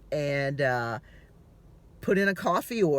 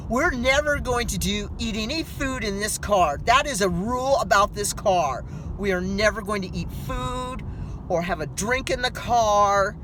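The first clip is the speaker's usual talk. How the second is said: louder, stern rule-making voice; mock vow; 6 semitones higher, 5 dB louder than usual. New car owner swears no food in the car, sternly. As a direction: lay down the law, hammed-up. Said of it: "you make all these promises".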